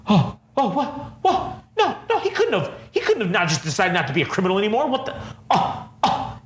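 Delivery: high, laughing, sputtering disbelief; flustered mock Daredevil. Mocking Daredevil's high, flustered shock at the news. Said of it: "fucking Daredevil was like".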